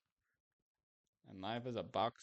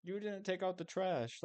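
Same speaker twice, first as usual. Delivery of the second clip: higher, mock-whiny nag about chores; nagging partner; 8 semitones higher than usual. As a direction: imitate a bickering partner's complaint. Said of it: "they'll argue over".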